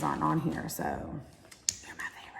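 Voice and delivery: unvoiced, hushed favoritism; whispered confession. Real, not acted. Aunt whispers to niece she is the favorite, hushed. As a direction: whisper it like a secret. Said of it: "whispered answer to niece".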